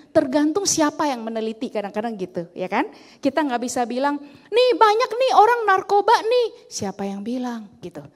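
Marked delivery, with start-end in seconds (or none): 4.5-6.7 high, loud imitation of someone raising an alarm; pitched-up, louder mock exclamation; mock alarmist claim. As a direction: blurt it out like an overexcited accuser. Voicing an alarmist claim being rebutted.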